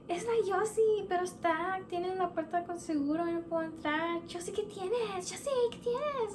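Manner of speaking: high-pitched mock frantic mother; panicked mom. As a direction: imitate mom fretting up high at a locked door. Panicked mom explains, calls through door.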